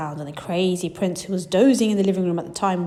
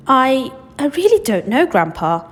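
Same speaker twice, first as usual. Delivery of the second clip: high, louder hesitant stammer; 6 semitones higher, 6 dB louder than usual. Acted. Charlie unsure before Grandpa, stammering boy. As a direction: stumble over the start. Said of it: "Charlie stammered".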